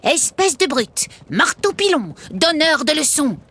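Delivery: a weary little puppet hurling names; exasperated puppet. Acted. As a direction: Niafron the puppet exasperated with Guignol. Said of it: "Niafron soupirait".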